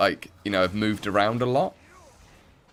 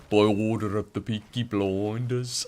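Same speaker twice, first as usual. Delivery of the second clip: put-on slow, drawn-out Birmingham accent; Peaky Blinders Brummie; 2 semitones higher than usual. Streamer quotes the show to chat in slow Brummie. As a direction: deliver the famous line in a hammed-up Brummie. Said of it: "That's probably the worst impression".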